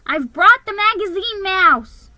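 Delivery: high, loud proud elf; elf announcing. A narrator's reading. Elf telling Santa he brought the mouse, high and proud. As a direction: present your guest proudly. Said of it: "he announced".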